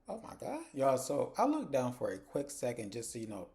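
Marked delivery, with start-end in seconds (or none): 0.1-0.6 high, stunned oh my God; a high-pitched shocked aside; shocked gasp. Real, not acted. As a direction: react in disbelief. Shocked reaction to the scene.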